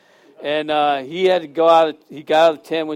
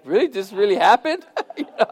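laughing; 4 semitones higher, faster than usual. Real, not acted. Mock disbelief, laughing.

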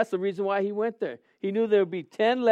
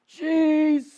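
high, loud, long cry to heaven; acted scream; 10 semitones higher, 5 dB louder than usual. Preacher re-enacting his desert prayer, screamed cry. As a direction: yell it from the top of the lungs. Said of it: "I scream".

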